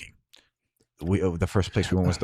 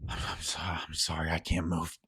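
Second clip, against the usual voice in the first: softer, lower, faltering; weak, dying; 3 semitones lower, 6 dB quieter than usual. Acted. Wounded Harlan telling Cersei he cannot move. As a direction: barely get it out, failing. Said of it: "he goes".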